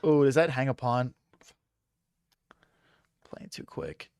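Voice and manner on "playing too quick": whispering. Real, not acted. Muttered self-criticism.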